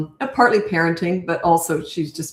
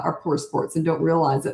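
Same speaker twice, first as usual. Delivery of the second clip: ordinary talk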